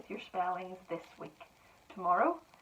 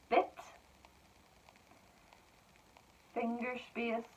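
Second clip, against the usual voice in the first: slow dictation; slower than usual. Shown as a demonstration. Teacher dictating to pupils, words spaced apart. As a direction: spell it out for children, word by word.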